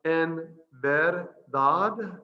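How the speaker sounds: "En verdad" drawn out very slowly, each vowel held; slow Spanish demo. Shown as a demonstration. Teacher gives the response for learners, slow and drawn out. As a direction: stretch "En verdad" out for learners to copy. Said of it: "the response is".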